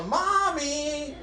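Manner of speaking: high sing-song call up the stairs; little girl calls her mom, high shouted call; calling mommy. Retold or imitated. Kid yelling for mom.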